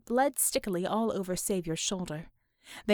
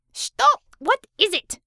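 high, loud irritated snap; sudden loud, impatient retort; snapped; 12 semitones higher, 10 dB louder, slower than usual. A narrator's reading. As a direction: snap back sharply at the caller. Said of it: "she snap".

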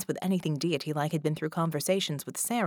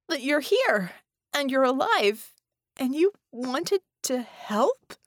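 higher, slow words pushed out; strained throat; 8 semitones higher, 5 dB louder than usual. A narrator's reading. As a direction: force the words through a burning throat. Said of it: "her throat burned, but she pushed the words out".

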